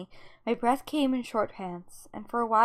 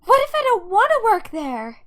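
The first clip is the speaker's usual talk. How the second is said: high, loud protest; defiant outburst; 9 semitones higher, 9 dB louder than usual. Acted. Daughter refusing dad's family company plan, defiant outburst. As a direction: push back hard, voice raised.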